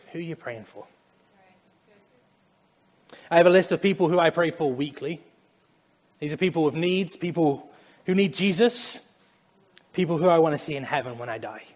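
usual speaking voice

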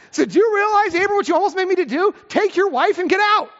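hammed-up outrage, like a king who was nearly tricked; exasperated Pharaoh.

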